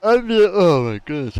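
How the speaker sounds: talking through a yawn. Real, not acted. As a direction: let a yawn stretch the words. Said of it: "I'm yawning".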